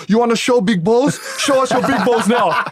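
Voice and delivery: high, hammed-up confrontational taunt; mock angry challenge. Croatian trainer goes right up to Nick, mock angry challenge. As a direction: imitate a trainer getting in someone's face, pitched high.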